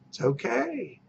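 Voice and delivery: It's okay voiced softly, falling at the end; soft reassurance. Shown as a demonstration. Agent soothing a worried seller, soft voiced it's okay. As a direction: say It's okay gently, with a small lift.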